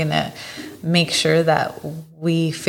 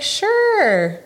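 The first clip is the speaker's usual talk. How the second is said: much higher, bright-eyed mock agreement; eager yes. Retelling her date, she mimics her own much higher eager yes. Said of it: "I was like".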